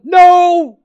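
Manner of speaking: No! shouted high and loud; emphatic shout. Acted. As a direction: cut the doubt off hard. Answering "this isn't my home?.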